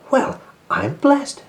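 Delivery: slow, high amazed exclamation; drawn-out disbelief at the log; astonished Percy. Acted.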